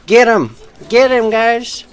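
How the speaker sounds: high, loud sarcastic rallying call; hammed-up call to attack; mock egging on. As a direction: rile up the crowd, all sarcasm.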